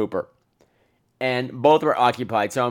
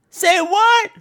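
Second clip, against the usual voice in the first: shocked; 7 dB louder than usual. Retold or imitated. Tin Man shocked.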